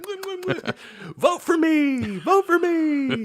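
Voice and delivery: put-on squeaky high baby smooching and cheering; baby kissing voice. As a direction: ham up a baby blowing kisses and chanting. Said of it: "he would just go like".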